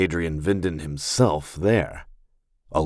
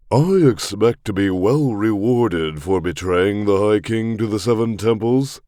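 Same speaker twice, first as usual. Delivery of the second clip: higher, louder, pompous nobleman; commanding pompous lord; 3 semitones higher, 4 dB louder than usual. Acted. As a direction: puff up like a self-important lord. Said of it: "He had a commanding but pompous voice".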